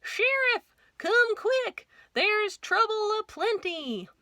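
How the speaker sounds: deputy shouting up for the sheriff; urgent yelling deputy. Acted. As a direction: hurry him out, shouting up. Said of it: "yelled Deputy Bob".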